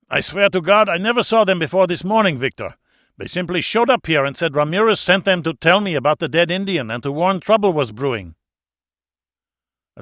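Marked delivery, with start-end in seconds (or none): 0.1-8.3 higher and louder, desperate protest; frightened pleading. A narrator's reading. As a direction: beg for your life, panicked. Dieter sweating, pleading with captor.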